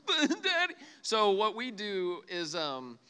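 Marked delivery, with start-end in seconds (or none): 0.1-0.7 put-on whiny toddler. Father imitating his crying son at the potty. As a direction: whine like a melting-down toddler. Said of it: "full-on meltdown".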